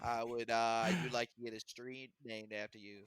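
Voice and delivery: low, soft politician impression; hesitant mock statesman, dropped in pitch; Mayor Quimby. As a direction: do a quiet cartoon mayor. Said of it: "Him and Mayor Quimby".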